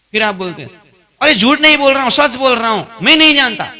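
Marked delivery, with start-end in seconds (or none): 1.2-3.7 insistent protest. Acted. Listener denies knowing to teacher.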